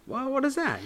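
high-pitched mock bafflement; puzzled reaction. Imitating visitors puzzled by the sign, baffled. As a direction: voice it as a baffled onlooker.

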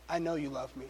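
quietly moved; hushed awe. Retold or imitated. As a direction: say it softly as if to heaven. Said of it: "She goes".

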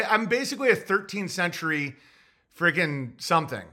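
usual speaking voice

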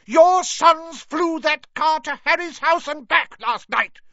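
very high, loud shout. Acted. Molly shouting at Arthur about their sons, high and loud. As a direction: yell it across the kitchen. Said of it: "shouted Missus Weasley".

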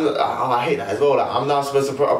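ordinary delivery

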